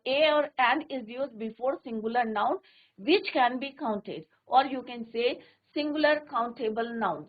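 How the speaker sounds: slow, word by word, for copying; slow dictation. Shown as a demonstration. Teacher reads the rule aloud slowly for the class. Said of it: "teacher dictating the rule".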